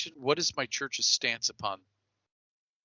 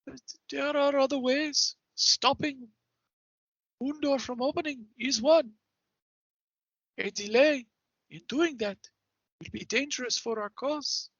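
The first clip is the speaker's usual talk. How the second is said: higher, formal character voice, measured phrases; cautious party member in character; 14 semitones higher than usual. Acted. Warning a proud companion against risking the mission, solemn in character. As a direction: reprove a companion in a lofty, higher voice. Player speaks as their character.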